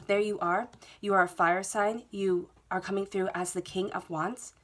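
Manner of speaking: ordinary talk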